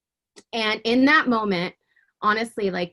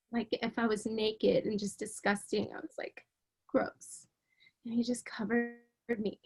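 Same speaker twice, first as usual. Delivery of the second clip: whispering; 10 dB quieter than usual. Real, not acted. Emotional retelling of rescue.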